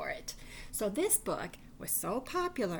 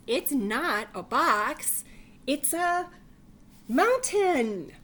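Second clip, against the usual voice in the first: slow, loud and high, proud of the mountain; loud insisting bunny; 4 semitones higher, 9 dB louder, slower than usual. Acted. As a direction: belt it out like a proud little bunny. Bunny insists in read-aloud story.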